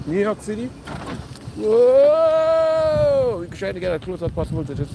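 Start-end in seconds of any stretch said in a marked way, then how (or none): 1.6-3.4 surprised whoa. Real, not acted. Seeing the landmark.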